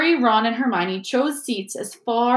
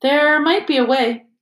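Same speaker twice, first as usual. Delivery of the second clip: higher, louder, measured suggestion; slow and tentative; 7 semitones higher, 7 dB louder than usual. A narrator's reading. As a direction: float the idea deliberately. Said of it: "said Hermione slowly".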